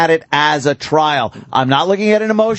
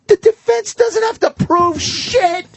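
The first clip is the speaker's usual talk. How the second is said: exasperated rant; 13 semitones higher than usual. Real, not acted. Mocking caller, ranting.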